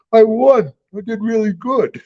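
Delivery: mocking a boastful wrestler. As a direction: play a proud kid bragging, lightly. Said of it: "it's like".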